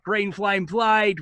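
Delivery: mock yell, slow, high, Boston vowels; Bostonian battle yell. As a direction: call out the spell in a Bostonian accent. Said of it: "which he always yells out".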